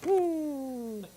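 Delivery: high drawn ooh; a happy cooing ooh; high pleased ooh; delighted ooh. Real, not acted. Reacting to the garlic.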